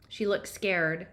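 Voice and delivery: ordinary delivery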